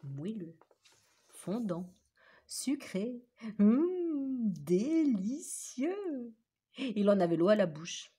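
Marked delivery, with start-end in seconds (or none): 3.6-6.3 slow, dreamy hum of pleasure; mouth-watering delight, drawn out; savoring. A narrator's reading. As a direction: drag out délicieux like tasting it. Lou dreams of his cake.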